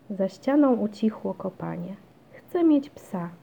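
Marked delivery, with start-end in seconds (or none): 2.3-3.3 high, louder demand; insistent child. A narrator's reading. Basia insists to mum she wants a dog, crying out. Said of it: "krzyknęła Basia".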